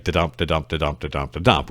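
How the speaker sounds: da-dump said as a steady da-DUMP, beat on the second syllable; iambic da-dump beat. Shown as a demonstration. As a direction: tap out da-dump like a drum. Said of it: "which is iambic pentameter".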